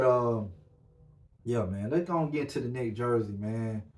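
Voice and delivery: ordinary delivery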